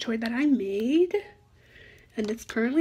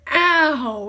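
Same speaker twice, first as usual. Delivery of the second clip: real sudden yelp of pain, louder; louder startled ouch at a snap; 3 semitones higher, 8 dB louder than usual. Real, not acted. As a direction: caught off guard by a sting. Popper snapped against her finger.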